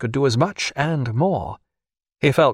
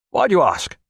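sharp; 3 semitones higher than usual. A narrator's reading. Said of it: "said Herapath sharply".

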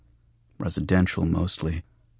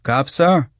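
high, loud wheedling tout voice; high-pitched hustler's hail; street tout; 9 semitones higher, 6 dB louder than usual. Acted. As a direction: call out high and eager to a mark. Tout hailing a customer.

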